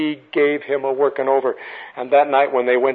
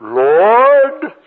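loud, drawn-out Southern "Lord"; higher, hammed-up Southern child's accent; 4 semitones higher, 5 dB louder than usual. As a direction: imitate a little Southern boy starting a prayer. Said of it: "Got a strange accent".

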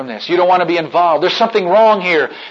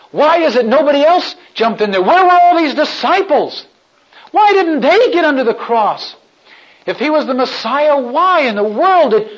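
much higher, louder urgent questions; agitated questioning; 10 semitones higher, 5 dB louder than usual. Acted. Bewildered man demanding why no disciple carried the cross. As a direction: demand answers in rising disbelief.

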